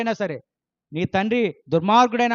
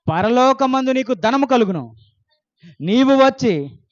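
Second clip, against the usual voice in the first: louder, raised proclamation; preaching Jesus's words; 4 dB louder, slower than usual. Acted. Pastor voicing Jesus's answer to the rich man, proclaimed loudly. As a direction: declare the Lord's answer with force.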